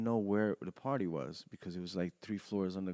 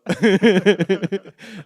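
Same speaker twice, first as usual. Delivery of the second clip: loud laugh; 9 semitones higher, 16 dB louder than usual. Real, not acted. Laughing at his escape.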